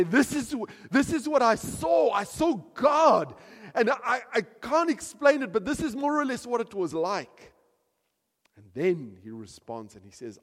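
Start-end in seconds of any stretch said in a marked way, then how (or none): 0.0-7.5 loud, high shouted awe; Daniel's awe. Retold or imitated. Daniel explaining his vision, loud awestruck shout. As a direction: shout it out, overwhelmed.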